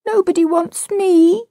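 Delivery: put-on high, plaintive little boy; a small boy on the verge of crying; near tears. A narrator's reading. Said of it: "Michael had nearly cried".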